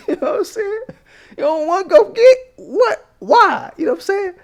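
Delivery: incredulous squeak. Pretend. Mocking the man for not snapping back, high incredulous squeak. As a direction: squeak it out in mock amazement.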